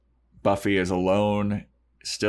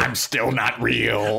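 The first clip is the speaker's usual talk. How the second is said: slow, higher puppet taunt; playful imitation of a talking dummy; mock dummy voice; 4 semitones higher, 3 dB louder, slower than usual. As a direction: draw it out in a teasing put-on voice. Voicing Xander's dummy teasing Buffy.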